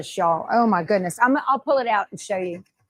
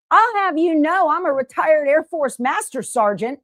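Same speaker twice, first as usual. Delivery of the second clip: higher, firm, commanding rank-pulling; master sergeant voice; 6 semitones higher, 4 dB louder than usual. Retold or imitated. Retired master sergeant correcting the vet who dismissed her, commanding. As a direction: put him in his place like a master sergeant. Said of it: "I sounded like a master sergeant".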